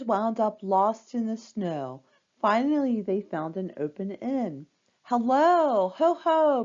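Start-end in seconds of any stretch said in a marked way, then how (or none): 5.0-6.6 high, loud jolly innkeeper hello; hearty greeting. A narrator's reading. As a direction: call out a big welcome to guests. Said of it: "innkeeper Christy Clausen greeted the girls".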